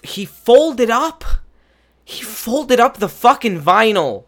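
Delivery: angry customer losing it over the folded vinyl; furious outburst. Real, not acted. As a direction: yell it in disbelief. Furious rant about folded record.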